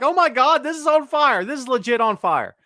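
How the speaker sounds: retold fan hype; excited quote. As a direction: rave like you're watching it live. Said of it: "I'm like".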